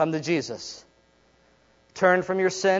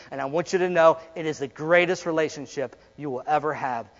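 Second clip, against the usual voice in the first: normal talking voice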